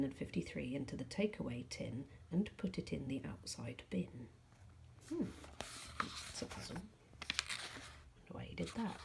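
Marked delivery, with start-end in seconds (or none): 5.1-9.0 hushed aside. Real, not acted. Reader's hushed aside after chapter.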